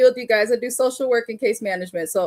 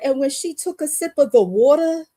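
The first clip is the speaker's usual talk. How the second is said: imitated East Coast accent, higher pitched; 6 semitones higher, 3 dB louder than usual. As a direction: quote your friend in her East Coast accent. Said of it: "I love an East Coast accent".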